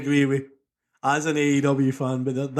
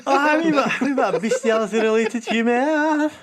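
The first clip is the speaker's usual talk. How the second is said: laughing delight; 10 semitones higher than usual. Real, not acted. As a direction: still cracking up, praising the joke. Still laughing after the joke.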